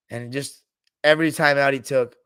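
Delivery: ordinary talk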